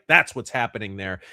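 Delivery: usual speaking voice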